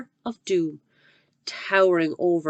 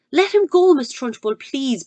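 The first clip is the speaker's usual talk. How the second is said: higher, louder urgent plea; begged; 7 semitones higher, 7 dB louder than usual. Acted. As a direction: beg her to stop. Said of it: "begged Miss Honey".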